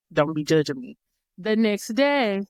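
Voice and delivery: usual speaking voice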